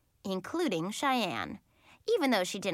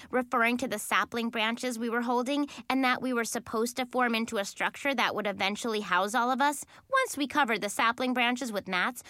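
plain reading voice

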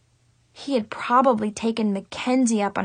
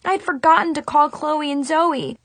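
plain reading voice

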